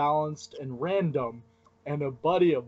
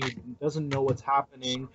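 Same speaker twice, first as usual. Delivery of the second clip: normal talking voice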